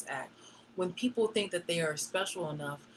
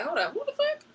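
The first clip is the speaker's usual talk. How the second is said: shocked outburst; 14 semitones higher, 5 dB louder than usual. Retold or imitated. Hearing a threat against him.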